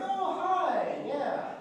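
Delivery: high, sugary fake greeting; a rival greeted with fake, high-pitched cheer; fake cheerful hello. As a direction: greet her with a fake smile. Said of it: "fake, big fake smile".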